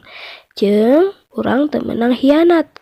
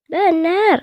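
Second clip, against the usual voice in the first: crocodile answering; 6 semitones higher than usual. Acted. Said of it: "tembal buaya".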